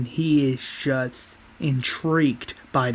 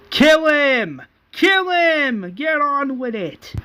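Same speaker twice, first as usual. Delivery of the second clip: high, loud bloodthirsty yell; screaming crowd; 11 semitones higher, 3 dB louder, slower than usual. Acted. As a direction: yell like a mob egging on a killing. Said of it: "crowd screaming".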